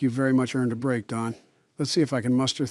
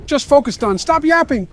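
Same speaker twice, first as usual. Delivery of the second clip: high, loud urgent shout; being chased; 8 dB louder than usual. Real, not acted. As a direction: yell at him to focus.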